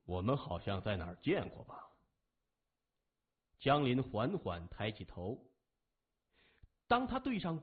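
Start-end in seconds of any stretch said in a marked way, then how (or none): 0.0-1.9 low, heavy gang leader; gruff boss voice. A narrator's reading. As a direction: drop low and weighty. Gang boss voice, much lower.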